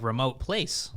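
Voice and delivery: ordinary talk, nothing marked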